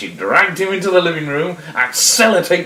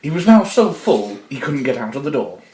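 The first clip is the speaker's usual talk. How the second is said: usual speaking voice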